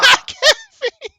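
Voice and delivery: squealed high through laughter; laughing mockery. GM squeals the joke while laughing.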